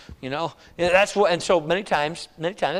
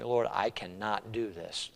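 soft, slow, low admission; hushed helpless plea; 7 semitones lower, 12 dB quieter, slower than usual. Acted. Gideon telling the Lord he cannot, hushed. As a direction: say it slowly under your breath. Voicing Gideon doubting before God.

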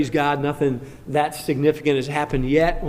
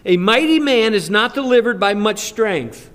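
scripture reading; 5 semitones higher than usual. Acted. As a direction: proclaim the verse with weight. Reads scripture aloud.

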